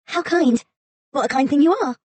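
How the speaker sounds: high, small frightened fox voice; timid little fox. A narrator's reading. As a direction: play a meek fox shrinking back.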